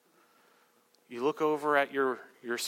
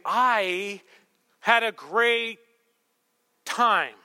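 higher, louder, drawn out; insistent date talk; 7 semitones higher, 6 dB louder, much slower than usual. Acted. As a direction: push it out emphatically, word by word. Third, insistent try on date.

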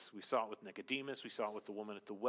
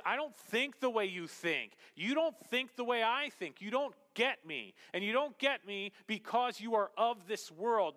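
high, loud, driving sermon voice; preaching at full force; 9 semitones higher, 6 dB louder than usual. Acted. Pastor to his congregation on worldliness, preaching at full force.

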